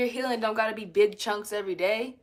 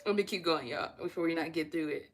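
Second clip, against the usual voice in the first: ordinary talk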